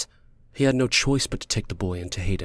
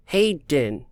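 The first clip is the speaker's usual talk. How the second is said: put-on "Hayden" in a higher child voice; higher-pitched, earnest childish "Hayden"; little boy; 5 semitones higher, 4 dB louder than usual. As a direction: say "Hayden" like a child trying hard. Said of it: "he would say every time".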